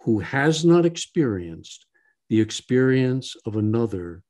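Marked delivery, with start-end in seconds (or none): none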